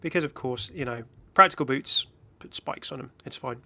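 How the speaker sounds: usual speaking voice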